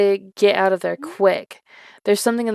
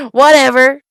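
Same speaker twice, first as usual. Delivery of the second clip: laughing; 5 semitones higher, 9 dB louder than usual. Real, not acted. Laughing off her own shrug.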